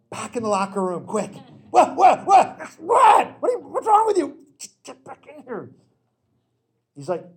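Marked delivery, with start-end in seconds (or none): 1.7-5.7 loud, high, frantic yelling at a friend; alarmed shout. Retold or imitated. Swimmer frantically shouting at his friend to get back inside. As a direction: hurry him back in, half laughing alarm. Urgent call to torn Speedo friend.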